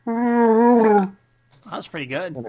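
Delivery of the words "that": long, loud Wookiee groan; Wookiee roar. Pretend. Friend shows how they all sound, loud mock Wookiee roar. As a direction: bellow a drawn-out Wookiee call. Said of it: "So we all sound like Wookiees".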